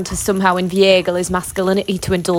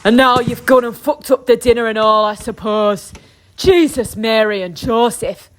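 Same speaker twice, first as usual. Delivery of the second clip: furious husband outburst; 2 semitones higher, 4 dB louder than usual. Acted. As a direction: erupt at the wife in fury. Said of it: "marches to the door in fury".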